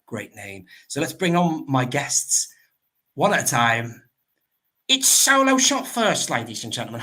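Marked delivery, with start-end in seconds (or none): 4.9-7.0 announcer intro. Acted. Host introducing his first guest to the chat, announcer style. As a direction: present the guest like a ring announcer.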